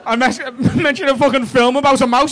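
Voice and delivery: ordinary talk, nothing marked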